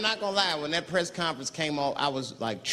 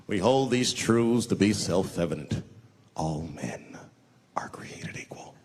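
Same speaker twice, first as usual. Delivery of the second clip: slow, lower founding-father speech fading to a hush; solemn recitation; 6 semitones lower, slower than usual. Retold or imitated. George Washington reciting the Declaration, solemn. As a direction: recite it grandly.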